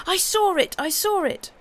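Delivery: high, loud, thrilled shout. A narrator's reading. Pip jumping round the block, shouting high with glee. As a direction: yell it out, high and excited.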